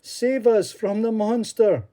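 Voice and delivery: high, loud pleading cry; begging. Acted. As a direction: beg him desperately. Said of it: "they begged Columba".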